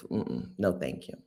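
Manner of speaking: low, soft, falling no thank you; muted refusal. Real, not acted. Speaker turning down the country, low muted refusal. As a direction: drop it low, almost to yourself.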